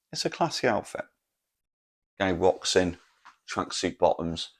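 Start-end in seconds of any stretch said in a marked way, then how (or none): 0.1-1.0 "classy" repeated, still raised but nearer normal; lighter posh echo. Shown as a demonstration.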